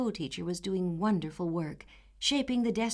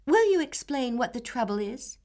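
put-on composed, dignified young girl, higher; 6 semitones higher, 5 dB louder than usual. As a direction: ask with cool dignity. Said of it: "in her most dignified manner".

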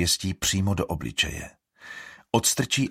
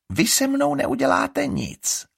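mocking sneer; 4 dB louder, slower than usual. A narrator's reading. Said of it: "posměšný úšklebek".

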